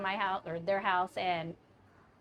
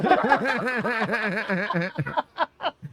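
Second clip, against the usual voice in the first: big laugh; 3 dB louder than usual. Real, not acted. Laughing at the punchline.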